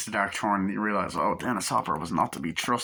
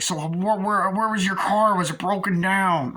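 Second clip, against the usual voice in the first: lightly raised, louder questioning voice; a hint of a curious Dennis Hopper, higher; Dennis Hopper impression; 8 semitones higher, 4 dB louder than usual. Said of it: "that's a good impression".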